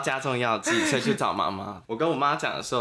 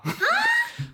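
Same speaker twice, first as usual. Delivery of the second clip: a squealing laugh far above the speaker's voice; very high burst of laughter. Real, not acted. Mom calmly already knew. (突然爆出高亢的尖笑)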